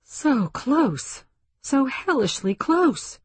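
high, overwrought exclamation; near hysteria. A narrator's reading. Woman inwardly reels at the near miss, near hysteria. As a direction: hysteria just held down, pitch swooping high. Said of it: "She felt hysteria close to the surface".

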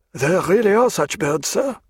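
high-pitched junior officer, protesting; earnest lieutenant. Acted. Red-haired lieutenant defending prisoner to colonel, earnest.

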